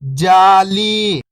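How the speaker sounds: whole word, level then falling at the end; syllables blended. Shown as a demonstration. Teacher showing beginners the joined word, syllables blended. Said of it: "तो मिलके बन जाएगा".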